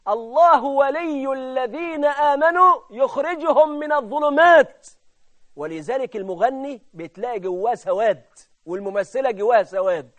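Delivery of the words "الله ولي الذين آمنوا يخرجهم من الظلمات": slow, raised, ringing preacher's voice; emphatic high declamation, spoken rather than chanted; declaimed scripture. Real, not acted. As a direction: proclaim the verse word by word.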